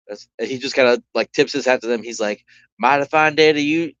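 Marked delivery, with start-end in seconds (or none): 2.8-3.9 put-on tip the hat, greet in a Southern accent. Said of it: "No, no, he's not Southern".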